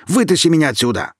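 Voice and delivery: high, loud, fast demand; desperate shout. A narrator's reading. Kirill shouting at Alina to pull him out. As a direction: yell it in a rush. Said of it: "крикнул парень".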